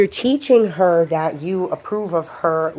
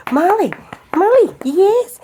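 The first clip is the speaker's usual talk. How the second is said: much higher, slow sing-song calls; calling the dog; 13 semitones higher, 4 dB louder than usual. Real, not acted. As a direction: lure Molly with a sweet call.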